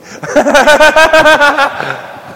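loud high burst of laughter; real hearty laugh; big laugh. Real, not acted. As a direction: crack up laughing. Laughing at own story.

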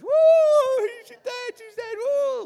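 loud high-pitched theatrical wail; mock wailing. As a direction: wail like a hired mourner, hammed-up. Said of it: "all the professional wailers".

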